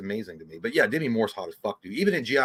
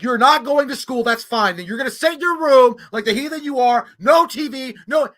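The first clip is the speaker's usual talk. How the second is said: loud, high hammed-up scolding; mom yelling; 10 semitones higher, 7 dB louder than usual. Mom grounding her kid over school, loud mock yelling. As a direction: lay down the law like a furious mother. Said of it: "like when your mom was yelling at you".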